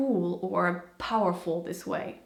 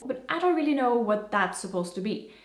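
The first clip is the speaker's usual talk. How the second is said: ordinary delivery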